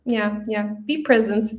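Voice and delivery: ordinary talk, nothing marked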